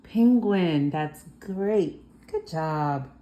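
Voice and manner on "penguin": Penguin said slowly and clearly as PEN-gwin; clear pronunciation demo. Shown as a demonstration. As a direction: model the word Penguin for the child, each sound clear.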